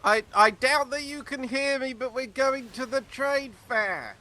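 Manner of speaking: loud, high call to a far ship. Real, not acted. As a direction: shout across the water. Calling out to distant ship.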